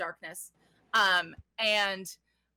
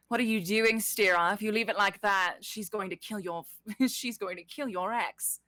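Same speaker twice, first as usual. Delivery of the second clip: louder, urgent in-character challenge; urgent confrontation; 4 dB louder than usual. Acted. Shadowy figure warns Stira, urgent and loud. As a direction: confront her urgently, starting loud and easing off.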